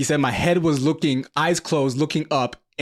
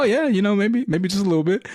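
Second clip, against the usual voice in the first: put-on much higher, sheepish drunk understatement; drunk denial; 8 semitones higher than usual. Drunk son telling dad he had only a little, high and sheepish. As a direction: play it innocent, a little too high. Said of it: "And he's like".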